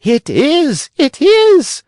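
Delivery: very high, loud shriek; delighted shriek. A narrator's reading. Signora Rosaura shrieking from the side of the stage, very high. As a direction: shriek it with joy. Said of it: "shrieked Signora Rosaura".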